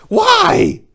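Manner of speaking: high, loud desperate why; anguished cry. A narrator's reading. Narrator reacting to the death sentence, anguished cry. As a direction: cry out in disbelief.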